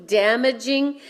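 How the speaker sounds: normal talking voice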